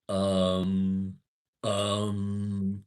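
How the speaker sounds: "um" stretched very long and loud; imitated filler word. Mimicking a nearby town's filler habit, long drawn-out "um". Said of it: "every other word that they said wasn't a word".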